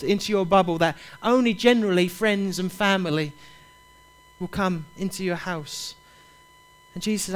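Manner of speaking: ordinary talk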